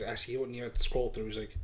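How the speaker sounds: ordinary delivery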